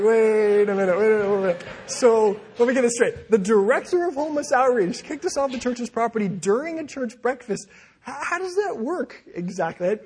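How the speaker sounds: loud, high-pitched mock disbelief; acted-out bafflement at full volume; incredulous. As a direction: demand an explanation, stunned and loud.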